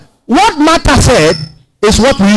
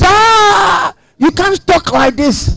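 high, drawn-out gasp of protest; shocked objector; 7 semitones higher, much slower than usual. Acted. Imagined critic objecting to such talk, shocked. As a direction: object in a raised pitch, opening on a long "Ah". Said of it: "when you talk like this".

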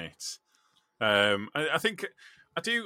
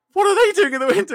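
high, fast, hammed-up Irish priest asking; mock-baffled young priest, pitched up and quick; Dougal quote; 12 dB louder, faster than usual. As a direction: squeal it out, puzzled and loud. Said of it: "Dougal goes".

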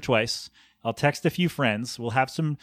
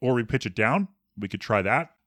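a lower, altered voice as a trial; pitched down; 3 semitones lower than usual. Shown as a demonstration. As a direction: drop the voice down for the test. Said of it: "Should we pitch it up or down?".

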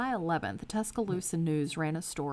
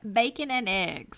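mock kid echo; 3 semitones higher, 6 dB louder than usual. Echoing the kid's line.

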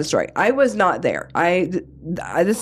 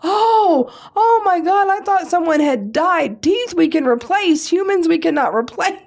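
relieved exclamation; 10 semitones higher than usual. Retold or imitated. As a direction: laugh off the panic with disbelief. Wife relieved after feared death.

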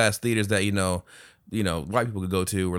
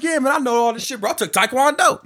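boastful dad; 3 dB louder than usual. Retold or imitated.

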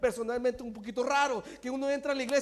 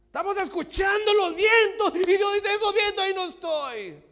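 higher, louder preaching call; preaching shout; 5 semitones higher, 6 dB louder than usual. Acted. Preacher to the congregation, loud and raised. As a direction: proclaim it over the congregation.

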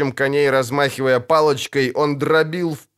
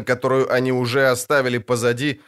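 ordinary delivery

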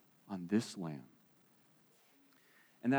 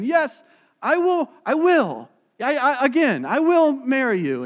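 acted delight; 8 semitones higher, 8 dB louder than usual. Acted second proposal acceptance.

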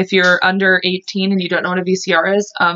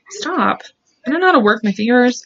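ordinary talk, nothing marked

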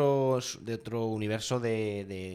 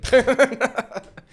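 carcajada aguda y fuerte; 11 semitones higher, 11 dB louder than usual. Real, not acted. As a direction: estalla en una risa alta. Carcajada tras "me ducho. (se ríe a carcajadas del comentario del compañero)

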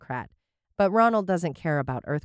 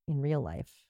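suggestive aside; 9 semitones lower, 10 dB quieter than usual. Acted. Innuendo aside.

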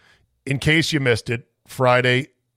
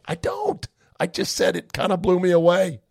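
defensive squeak; 7 semitones higher than usual. Real, not acted. Host defending himself against a listener's accusation. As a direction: voice jumps way up in protest.

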